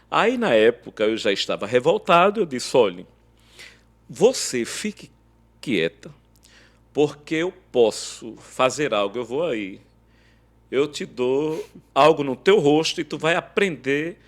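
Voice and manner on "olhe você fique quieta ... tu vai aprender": louder, hard threatening tone; angry threat. Retold or imitated. Husband threatening his wife in a rage, angry loud threat. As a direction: bark the threat at her.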